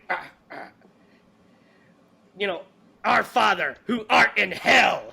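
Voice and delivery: a hint of a ranting preacher, with laughter; hammed-up villain prayer. Quoting the villain's prayer to friends, laughing hammed-up delivery. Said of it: "especially when he says".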